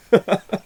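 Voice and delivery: short, real, high burst of laughter; amused laugh. Real, not acted. Reviewer cracking up at the silly names, amused laugh. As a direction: let out a quick high laugh.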